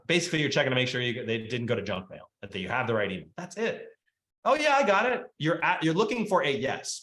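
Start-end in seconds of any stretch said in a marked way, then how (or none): 4.4-5.2 higher, louder breezy yes; imitating a cheerful client. Client answering the agent's call, breezy and upbeat. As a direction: play the client happily confirming the email.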